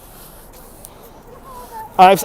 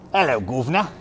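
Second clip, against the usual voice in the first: low, softer Cockney greeting; Cockney accent; 4 semitones lower, 6 dB quieter than usual. Acted. Streamer trying out the accent after talk of Cockney, Cockney greeting. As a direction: try a put-on Cockney hello. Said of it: "Oh, that's Cockney".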